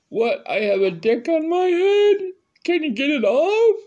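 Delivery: shrieking falsetto panic, half crying; high panicked shriek. Retold or imitated. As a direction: squeal in terror at a dick on the head. Panicked reply to the doctor.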